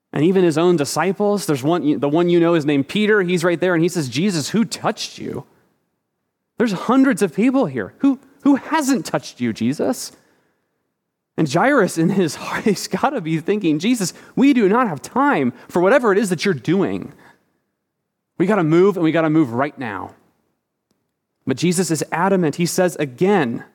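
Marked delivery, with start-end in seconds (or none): 4.1-10.1 incredulous Peter. Acted. Peter questions Jesus in crowd. 13.8-20.1 impatient urgency. Retold or imitated. Jairus impatient, thinking to Jesus.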